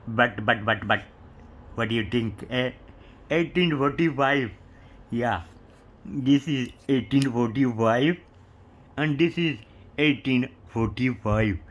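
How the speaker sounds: playful put-on Indian accent; jokingly doing an Indian English accent, rolling the numbers. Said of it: "maybe I should talk like an Indian".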